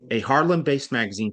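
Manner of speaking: ordinary delivery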